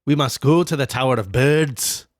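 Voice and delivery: a light attempt at a Scottish accent, mock grand; lightly put-on Scottish voice, hamming it up. Podcaster tries a Scottish accent on the bird line, lightly Scottish. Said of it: "we kinda have to do a Scottish accent".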